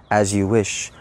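low, soft devoted reply; low lovesick servant. Retold or imitated. Farm boy answering the princess, low and soft. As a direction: murmur it like a smitten farmhand.

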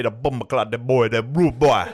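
put-on Jamaican accent. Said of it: "Jamaican accents".

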